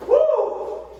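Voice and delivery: high loud woo; effort whoop. Real, not acted. Cheer mid exercise.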